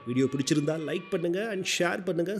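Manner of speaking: ordinary talk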